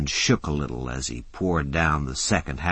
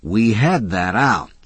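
impatient; 5 semitones higher, 6 dB louder than usual. A narrator's reading. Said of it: "said Mac impatiently".

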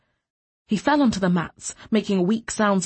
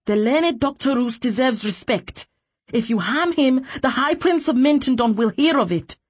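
higher, raised, forceful voice; defiant warning; 6 semitones higher, 4 dB louder than usual. A narrator's reading. Woman defending doctor from officer, defiantly warning. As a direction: warn him off with authority.

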